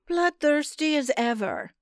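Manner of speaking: high, dry witch voice; a sly old witch, amused; witch remarking. A narrator's reading. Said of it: "the witch remarked".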